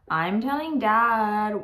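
drawn-out tattletale warning. Acted. Little sister threatens to tell Dad, warning. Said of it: "warned D W".

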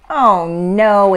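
loud, drawn-out storybook groan. A narrator's reading. Ava finds the peaceful park noisy, loud groan. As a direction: groan it out in mild dismay. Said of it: "Ava groaned".

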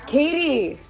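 calling a name across the house, high. Real, not acted. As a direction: call out to find her.

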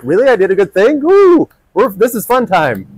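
lightly voiced little kid, thrilled at praise; a kid squealing with surprised delight, woo up high; excited five-year-old. A hint of a five-year-old delighted by the adult's thanks. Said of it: "imagine you got a five-year-old kid here".

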